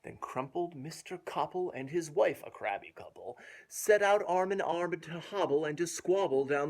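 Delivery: put-on lilting read-aloud rhythm for a child; rhymed story reading. A narrator's reading. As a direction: bounce through the rhyming verse. Rhyming verse continues.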